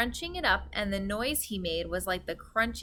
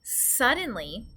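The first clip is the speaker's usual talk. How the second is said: plain reading voice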